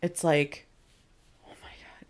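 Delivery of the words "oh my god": barely audible, breathy whisper; hushed, voiceless exclamation; awed whisper. Retold or imitated. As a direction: whisper it under your breath. Whispered reaction to the scene.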